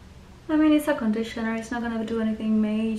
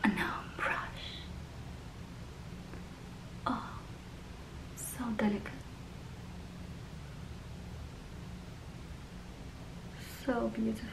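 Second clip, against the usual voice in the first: hushed, voiceless whisper; 16 dB quieter than usual. Real, not acted. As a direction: murmur it close to the mic. Whispers while applying makeup.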